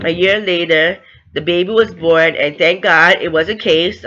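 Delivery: ordinary talk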